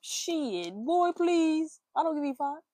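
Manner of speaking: put-on high, loud boy please; dismissive sass. Dismissing the lying man with sass. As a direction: brush him off, sassy and loud.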